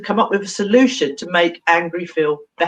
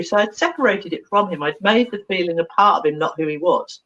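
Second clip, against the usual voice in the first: normal talking voice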